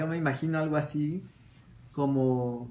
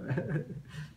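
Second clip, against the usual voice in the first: soft laugh; 3 semitones higher, 6 dB quieter than usual. Real, not acted. Laughs after Peter's answer.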